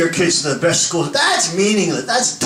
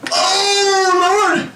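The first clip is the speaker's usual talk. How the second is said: mock panicked cry; 12 semitones higher than usual. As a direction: cry out like a terrified flyer. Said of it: "everybody's going".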